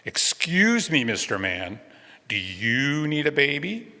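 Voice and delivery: high, slow sing-song request; Robin asking. A narrator's reading. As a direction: draw out the question, lifting it high. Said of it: "she goes over and she says".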